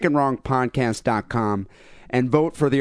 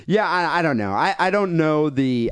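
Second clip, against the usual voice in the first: usual speaking voice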